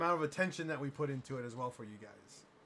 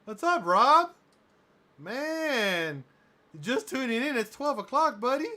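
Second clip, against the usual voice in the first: loud, high, cheery call-out; excited greeting; 6 semitones higher, 7 dB louder than usual. Real, not acted. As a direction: greet a friend who just arrived. Greeting a viewer joining the stream.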